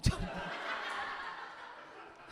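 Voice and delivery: a long, airy, unvoiced laugh; breathy laugh. Real, not acted. Laughing at husband's answer. (tertawa terbahak tanpa suara)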